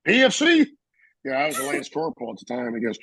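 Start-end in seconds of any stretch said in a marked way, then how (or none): 0.1-0.7 high, loud questioning shout; imitated sergeant's call. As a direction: call out a junior sharply by rank. Gunny calls out junior by rank.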